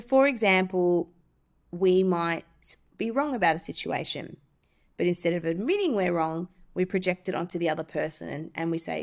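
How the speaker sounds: ordinary talk, nothing marked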